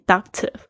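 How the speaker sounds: ductive with a firm beat on "duc"; stress on D. Shown as a demonstration. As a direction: hit the opening D syllable of ductive. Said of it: "重音在D上面".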